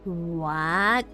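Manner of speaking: ordinary delivery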